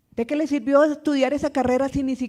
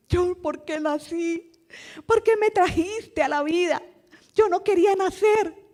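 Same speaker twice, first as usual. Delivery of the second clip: protesting in a raised, put-on young voice; child's reproach; 6 semitones higher than usual. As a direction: play a child reproaching the parents. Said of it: "como un reproche".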